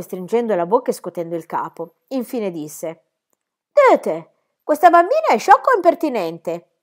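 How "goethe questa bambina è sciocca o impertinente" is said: high, loud, indignant governess voice; a strict lady scandalised by the name; stern governess. Acted. Rottenmeier interrogates the child about her name, high and indignant.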